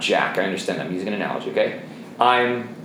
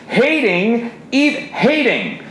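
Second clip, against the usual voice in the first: fiery preaching; 10 semitones higher, slower than usual. Acted. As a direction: hit the word hard, like a warning. Preaching with rising force.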